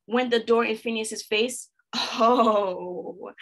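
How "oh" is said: ordinary delivery, nothing marked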